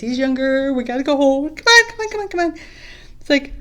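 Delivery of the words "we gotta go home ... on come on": much higher and louder, insistent; urgent calling. Acted. Batu urging Ringu to leave, loud insistent. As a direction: hurry him, tugging with your voice.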